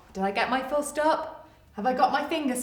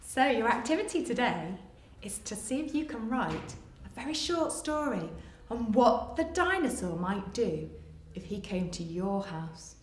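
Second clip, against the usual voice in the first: ordinary delivery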